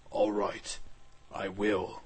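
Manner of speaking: low character voice; dragon's voice. Acted. Dragon accepting the mission from Blade, dragon's voice.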